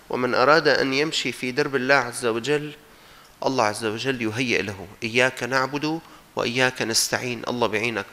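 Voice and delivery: ordinary talk, nothing marked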